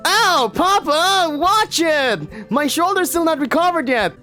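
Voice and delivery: hurt son scolding his father; high yelping boy. Acted.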